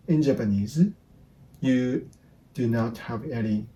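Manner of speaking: ordinary delivery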